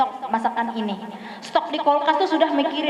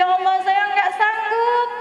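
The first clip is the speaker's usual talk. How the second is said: weary plea; 5 semitones higher than usual. Retold or imitated. Complaint to Allah again.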